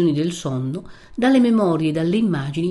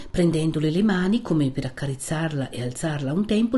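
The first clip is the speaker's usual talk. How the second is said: ordinary delivery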